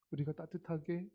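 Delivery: ordinary talk, nothing marked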